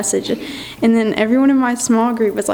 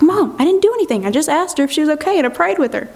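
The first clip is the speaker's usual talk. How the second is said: quick, higher-pitched protest to mom; defensive protest; 4 semitones higher, faster than usual. Retold or imitated. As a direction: retell it as a rushed, indignant defence. Retold protest to questioning mom.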